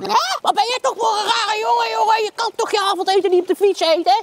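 high, laughing mock scolding; angry parent. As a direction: play the baffled scolding parent. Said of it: "mijn ouders altijd boos".

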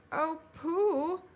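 high, anxious appeal; worried Piglet. A narrator's reading. Piglet turning to Pooh about a noise, worried. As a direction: call on a friend nervously.

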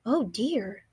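quiet letdown sigh; sad sigh. A narrator's reading. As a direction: let the disappointment show. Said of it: "the old woman said sadly".

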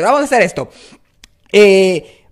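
ordinary delivery

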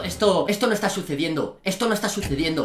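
ordinary talk

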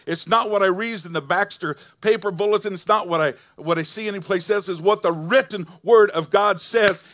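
loud, high preacher's call to rejoice; full-force preaching. Acted. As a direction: preach it out to the whole church.

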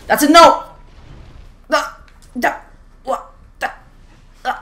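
sudden high, loud no; frustrated shout. Real, not acted. Gamer watching the round slip away, yelling. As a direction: yell the no as it goes wrong.

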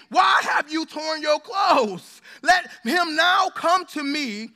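slow, loud proclaiming shout; fired-up preaching. Acted. Pastor getting carried away reading Elisha's words, loud and slow. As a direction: thunder out the prophet's words. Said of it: "I'm already ready to preach".

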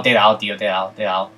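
usual speaking voice

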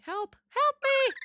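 high, drawn-out squeal for help; tiny high-pitched plea; mock panic. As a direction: squeak out a playful cry for rescue. Mock plea during game obby.

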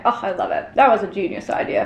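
normal talking voice

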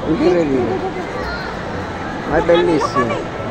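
ordinary talk

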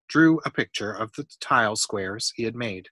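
usual speaking voice

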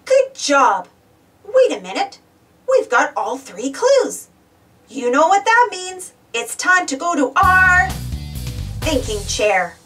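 usual speaking voice

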